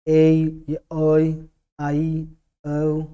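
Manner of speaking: very slow sounding of ए, ओई, आई, औ; vowel drill. Shown as a demonstration. Teacher sounding diphthongs for students, very slowly. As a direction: hold each vowel ए ओई आई औ long and apart. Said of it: "इसको ए अ बोलेंगे".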